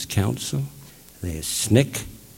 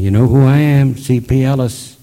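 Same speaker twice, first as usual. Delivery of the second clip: loud retold union speech; announcing; 3 semitones higher, 10 dB louder than usual. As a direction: declare it boldly, as if to the whole hall. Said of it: "I announce to the union".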